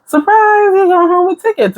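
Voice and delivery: high-pitched giveaway host imitation; mock surprise announcement. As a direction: announce a prize to the audience, voice lifted high. Imitates the show's ticket giveaway announcement.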